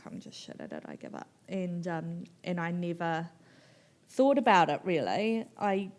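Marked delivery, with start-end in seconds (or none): 0.1-1.3 low, soft, defeated give-up; defeated mutter. Retold or imitated. To herself about her writing, low defeated mutter. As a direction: sink into a low hopeless mumble. Retold despair, giving up.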